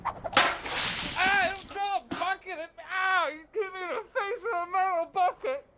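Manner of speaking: high pained shout; pained yelp. Acted. Hit by a thrown bucket.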